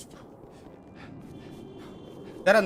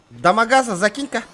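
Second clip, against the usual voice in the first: loud shouted request; yelling across the room; 6 semitones higher, 8 dB louder than usual. Real, not acted. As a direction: call out across the street. Calling out to another player.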